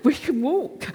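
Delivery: high, loud, joyful calling out; acted elation with bursts of laughter; overjoyed. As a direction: call the crowd along, bursting with joy. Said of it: "He is so full of joy".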